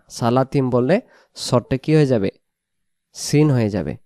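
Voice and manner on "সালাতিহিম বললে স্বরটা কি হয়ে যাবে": ordinary delivery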